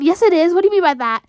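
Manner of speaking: ordinary talk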